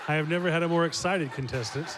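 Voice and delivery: loud sarcastic joke, laughing. Acted. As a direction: tease her, loud and dry. Joking at a reluctant contestant.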